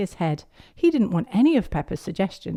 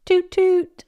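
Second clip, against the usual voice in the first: high, loud honking toot; playful horn noise; car horn toot; 13 semitones higher, 7 dB louder than usual. A narrator's reading.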